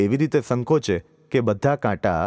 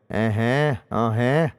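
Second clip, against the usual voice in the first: toothless chuckle; much slower than usual. Acted. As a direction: chuckle through a gummy mouth. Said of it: "એવું કંઈ હસ્યા".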